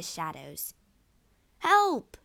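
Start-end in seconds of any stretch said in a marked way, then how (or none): 1.6-2.2 high, loud yell of alarm; yelled cry for help. A narrator's reading. Stabbed man calls out in the dark, yelling. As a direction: yell out for rescue. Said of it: "yelled Cassio".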